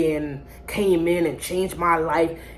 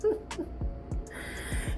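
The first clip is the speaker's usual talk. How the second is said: high unvoiced laugh; breathy disbelieving laugh; 13 semitones higher, 11 dB quieter than usual. Real, not acted. Laughing at his message.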